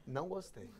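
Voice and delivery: soft, deadpan displeasure; offended wife. Retold or imitated. Offended wife reacting to husband's joke, soft curt displeasure. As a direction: quietly put out, imitated.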